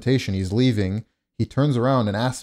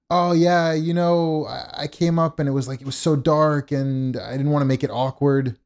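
higher-pitched mock excuse voice; imitated salesman; 5 semitones higher than usual. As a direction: mimic a sheepish salesman making excuses. Mimics salesman's awkward excuse.

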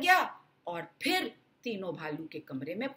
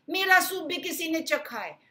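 mama bear shouting; 7 semitones higher, 4 dB louder than usual. Acted. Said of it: "मम्मा भालू चिल्लाई".

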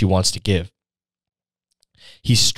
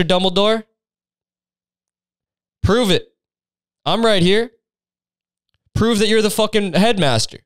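high, pushy mock confrontation; brash challenge; 8 semitones higher than usual. Student dares the headmaster to prove himself, brashly mocking. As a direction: goad him with cocky, comic insistence.

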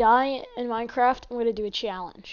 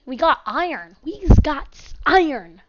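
loud, punchy exclamations; excited announcement; 3 semitones higher, 10 dB louder than usual. Pretend. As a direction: shout the find with playful excitement. Excited at finding iron.

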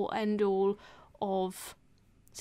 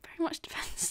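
whisper; 7 semitones higher, 7 dB quieter than usual. Real, not acted.

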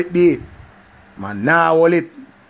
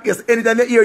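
ordinary talk

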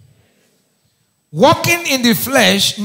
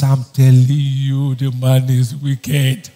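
slow, low insistent complaint; insistent wife; 7 semitones lower, slower than usual. Retold or imitated. Wife insisting to the pastor her husband is wicked, slow and low. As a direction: drag it out like a wife who won't budge. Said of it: "He says".

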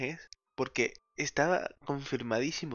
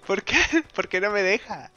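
exasperated pleading; 5 semitones higher, 5 dB louder than usual. Real, not acted. Game won't let him proceed.

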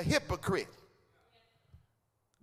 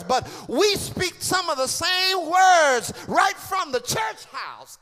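loud, rising preacher cadence; fiery preaching; 4 semitones higher, 7 dB louder than usual. Acted. Preacher calling out the church house, fiery preaching. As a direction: whoop it to the congregation.

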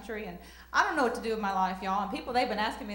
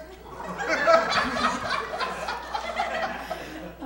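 real burst of high laughter; laughing; 8 semitones higher than usual. Real, not acted. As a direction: crack up at your own joke. Cracks up at own rap.

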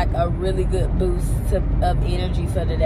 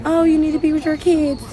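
lightly mocking judgmental mom voice, higher; mocking critics; 8 semitones higher, 3 dB louder than usual. Mocking the moms who judge her, higher and snide. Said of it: "to whoever".

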